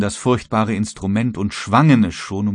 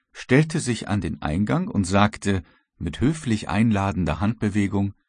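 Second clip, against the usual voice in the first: usual speaking voice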